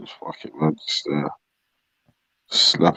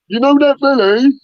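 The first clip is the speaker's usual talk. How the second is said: loud dopey voice; 5 dB louder than usual. Retold or imitated. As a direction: say it dumb and loud. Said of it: "This fucking loud dopey voice".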